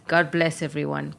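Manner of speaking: normal talking voice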